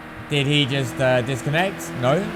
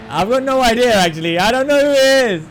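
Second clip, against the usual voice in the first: exasperated shout; 6 semitones higher, 6 dB louder than usual. Real, not acted. Streamer gives up, raising voice.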